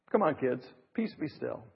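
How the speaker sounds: put-on soft, low and steady, hushing the kids; calm rational voice. Parent calmly hushing hyper kids, soft and low. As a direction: settle wild kids with one quiet word. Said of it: "say in a calm, rational voice".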